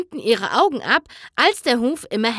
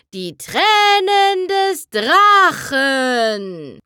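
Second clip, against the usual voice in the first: very slow, louder, ominous announcement; dramatic title; 6 semitones higher, 6 dB louder, much slower than usual. Acted. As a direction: draw out each word like a spell. (langsam und dramatisch)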